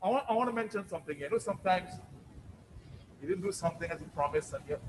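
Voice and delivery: normal talking voice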